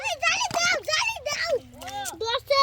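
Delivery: high, loud excited yell; urgent shouting. Real, not acted. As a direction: shout urgently for help with the catch. Urgent demand for the net.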